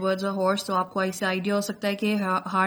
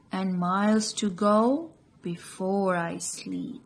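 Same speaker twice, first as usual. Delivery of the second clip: slow, measured verse reading with lifted phrases; poem recitation; much slower than usual. Shown as a demonstration. Teacher shows students how to read the poem, expressive recitation. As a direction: recite line by line, pausing between phrases.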